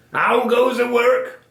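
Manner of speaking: high, loud, drawn-out farmer's call. A narrator's reading. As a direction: call out slowly as the lazy farmer. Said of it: "called the farmer".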